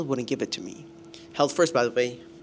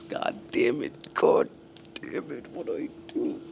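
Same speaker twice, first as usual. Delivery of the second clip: exasperated curse. Real, not acted. Vlogger annoyed over a parking ticket, exasperated curse. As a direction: let the frustration drag out.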